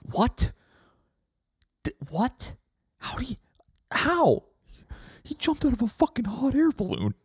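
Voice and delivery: very high put-on voice; shocked disbelief. Reacting to news of Daryl's death, high and shocked. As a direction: squeak it out in mock shock.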